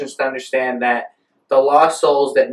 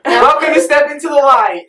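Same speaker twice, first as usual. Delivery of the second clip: hammed-up intro; 14 semitones higher, 6 dB louder than usual. Podcast intro slogan, hammed up.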